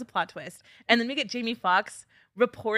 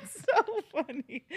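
laughing; 10 semitones higher, slower than usual. Real, not acted. Still laughing after joke.

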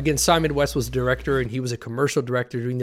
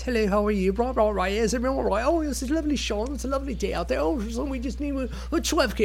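put-on British accent, pitched much higher, airy and chirpy; mock British director; 11 semitones higher than usual. British director chatting on set, mocked in a much higher British accent. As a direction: ham up a posh London crew chief being pleasant. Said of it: "your typical British metro male kind of guys".

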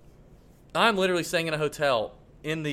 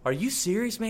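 retold stunned apology, pitched up; upset, feeling bad; 8 semitones higher than usual. Golfer learning he blocked a fan, retold as upset. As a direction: imitate a man mortified by the news. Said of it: "He felt so bad".

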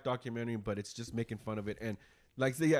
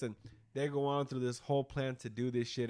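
ordinary talk

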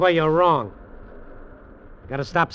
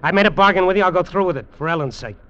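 angry; 4 semitones higher, 6 dB louder, faster than usual. Acted. Quarrel with uncle.

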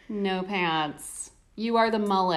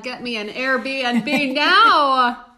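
excited; 3 semitones higher, 7 dB louder, much slower than usual. Real, not acted. Gushing over the Sicilian village.

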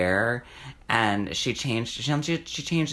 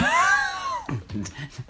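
very high shrieking laugh; high squealing laugh. Real, not acted. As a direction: burst out in a squeal. Laughing at topic change.

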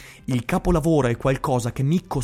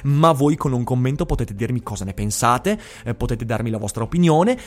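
usual speaking voice

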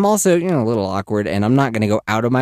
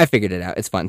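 normal talking voice

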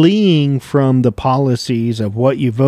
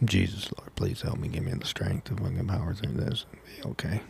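very soft, low plea; hushed, half-voiced appeal to heaven; muttered mock prayer; 6 semitones lower, 13 dB quieter than usual. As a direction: pray under your breath for patience.

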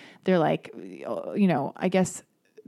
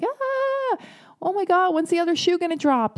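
much higher, mock panicked squeal; panicked inner scream; 12 semitones higher than usual. To herself about her relationship, a high panicked cry. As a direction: shriek the worry like you're losing it. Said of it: "internally screaming".

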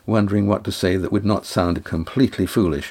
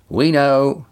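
higher, lightly raised reply; shouting back; 6 semitones higher, 4 dB louder than usual. A narrator's reading. Cam calls back to Estrella from the boat, mildly shouted. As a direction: answer with a contained shout. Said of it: "Cam shouted back".

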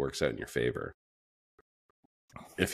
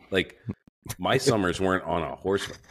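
usual speaking voice

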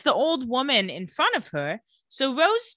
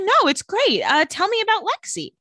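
higher, louder bubbly cover-up; eager Caroline; 10 semitones higher, 5 dB louder than usual. Retold or imitated. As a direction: gush to smooth over the awkward moment. Caroline eagerly changing subject.

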